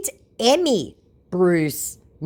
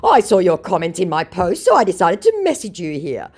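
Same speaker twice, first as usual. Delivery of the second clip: mock scammer voice; 6 semitones higher, 6 dB louder than usual. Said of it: "said my Marshall Bruce".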